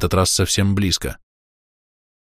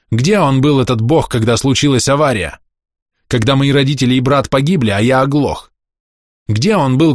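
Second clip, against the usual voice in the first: bitter outburst; 4 semitones higher than usual. Acted. Bitter outburst about God.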